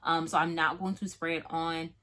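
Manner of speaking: ordinary talk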